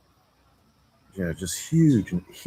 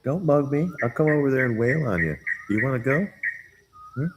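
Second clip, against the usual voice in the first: playful high-pitched challenge; mock threat; 6 semitones higher, faster than usual. Teasing a bird with a fight, mock threat. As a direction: pick a fake fight, voice pitched up.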